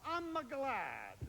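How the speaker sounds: put-on slow Italian accent, gliding a vowel into I'm a glad; mock Italian, extra vowel before glad. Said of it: "You've gotta glide into it".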